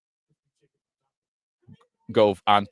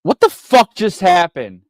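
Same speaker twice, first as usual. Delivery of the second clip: loud, higher outraged shout; angry disbelief; 8 semitones higher, 11 dB louder than usual. Real, not acted. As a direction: bark it out in disbelief. Fan stunned by another penalty.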